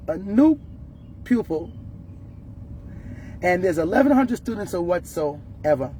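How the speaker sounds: ordinary delivery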